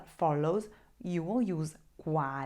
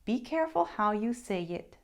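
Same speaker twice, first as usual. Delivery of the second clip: ordinary delivery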